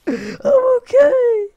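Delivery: very high, loud crying squeal; squealed, tearful fake breakdown; mock wail. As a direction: drag it out into a hammed-up sob. Falls apart after crashing in game.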